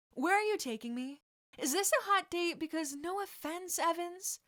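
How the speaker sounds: high, loud playful teasing; mock flirt to tease Evans. Flirty teasing after collapse.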